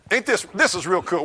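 ordinary talk, nothing marked

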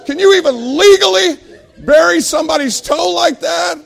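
loud, high ranting yell; comic outrage. Retold or imitated. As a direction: holler indignantly, hammering each phrase. Outraged rant at boss, retold.

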